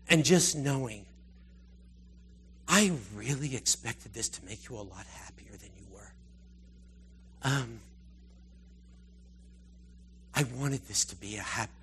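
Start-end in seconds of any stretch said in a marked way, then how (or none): 2.7-11.7 lower, softer, gentle and sorrowful; tender disappointment. Retold or imitated. Father to believer face to face, softly disappointed. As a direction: let it fall gently, almost hurt. God gently speaking at heaven meeting.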